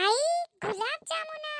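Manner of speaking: put-on a little girl thrilled by her favourite sweet; high childish squeal of joy; excited child.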